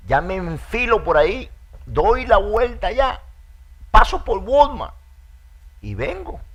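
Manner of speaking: hammed-up whiny promise to go tomorrow; mock excuses. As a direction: stretch out the lazy excuse, mocking it. Believer making lazy excuses to Spirit.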